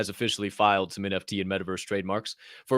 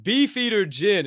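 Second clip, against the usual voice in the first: hyped repeat; 9 semitones higher, 4 dB louder, slower than usual. Shown as a demonstration. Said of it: "That's right".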